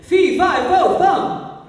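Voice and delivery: fairy tale giant chant; fee fi fo fum. Shown as a demonstration. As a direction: boom it like the giant. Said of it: "with that kind of".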